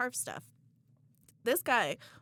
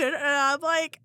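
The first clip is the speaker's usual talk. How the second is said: usual speaking voice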